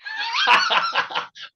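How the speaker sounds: big burst of real laughter. Real, not acted. Joke about old PS two.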